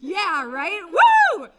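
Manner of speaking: sarcastic whoop up high and loud; fake-celebrate the coup with a shrieking woo; mock cheer. Comic mocking the crowd with a sarcastic cheer.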